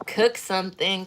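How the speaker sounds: ordinary delivery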